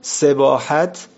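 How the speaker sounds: سباحت said sibahat, slowly syllable by syllable; سباحت dictated slowly with a rise; slow spelled-out word. Shown as a demonstration. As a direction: draw out سباحت for the class. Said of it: "عرب به شنا میگه سباحت".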